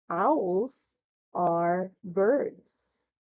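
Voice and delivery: very slow reading with spaced words; slow model reading. Shown as a demonstration. Teacher reads the sentence for pupil to repeat, slow model reading. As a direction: stretch out each word for repeating. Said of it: "Let's read the sentence".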